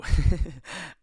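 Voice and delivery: short real laugh; burst of laughter. Real, not acted. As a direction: laugh out loud. Laughs at listener question.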